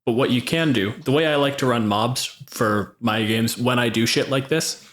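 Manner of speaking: ordinary talk, nothing marked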